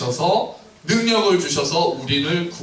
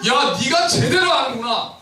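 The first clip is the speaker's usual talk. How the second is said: high, slow, impressed praise; Jesus praising Peter, impressed; admiring exclamation; 9 semitones higher than usual. Acted. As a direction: marvel at him slowly. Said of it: "예수님이 감탄하신 거예요".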